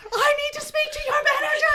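high, loud Karen voice; entitled customer. Retold or imitated. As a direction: demand the manager, laughing. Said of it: "Imagining all these women".

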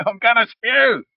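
high loud retching goblin. Acted. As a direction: whine it like a sick goblin. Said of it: "making all the noises of all the goblins".

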